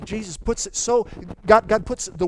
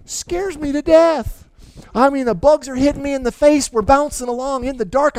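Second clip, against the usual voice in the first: louder and higher, almost shouting the fright; thrilled terror; 9 semitones higher, 9 dB louder than usual. Retold or imitated. Youth pastor recalling a wild midnight boat ride, excited shout. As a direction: relive the scary ride at full volume.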